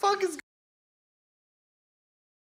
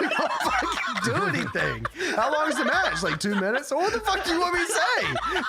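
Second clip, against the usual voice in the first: laughing mock rant; 7 semitones higher than usual. Mock frustrated wrestler voicing Roode.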